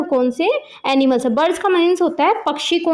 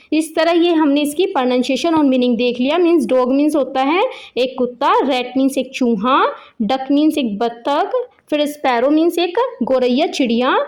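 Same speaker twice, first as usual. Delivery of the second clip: ordinary delivery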